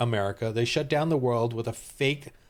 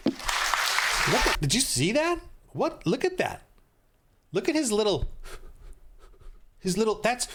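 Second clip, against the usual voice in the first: excited pointing; 6 semitones higher than usual. Real, not acted. Pointing at weaselly man on screen.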